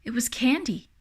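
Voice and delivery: ordinary delivery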